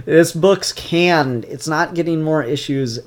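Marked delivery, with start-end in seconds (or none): 0.1-1.5 slow loud lament. Real, not acted. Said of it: "I'm sorely disappointed".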